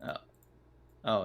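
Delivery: ordinary delivery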